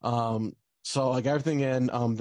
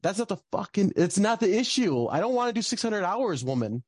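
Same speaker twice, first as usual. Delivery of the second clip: much higher, exasperated rant; indignant retelling to the supervisor; retold frustration; 8 semitones higher than usual. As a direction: vent it, pitched well up. Retold outburst at academic supervisor.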